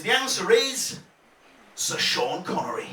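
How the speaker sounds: usual speaking voice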